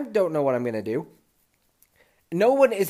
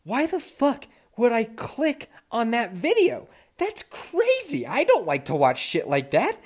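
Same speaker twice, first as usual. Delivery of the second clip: high, slow incredulous rant; high, slow shocked self-scolding; appalled disbelief; 9 semitones higher, slower than usual. Retold or imitated. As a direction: squeak out your disbelief at yourself. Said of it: "my first thought is".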